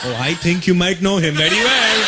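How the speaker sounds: put-on Indian-accented dad, smugly teasing; lightly voiced dad with a knowing laugh. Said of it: "I'm not gonna say who".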